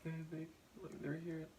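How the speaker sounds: half-whispered through tears as the family walks in; tearful relief. Retold or imitated. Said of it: "I immediately started to cry".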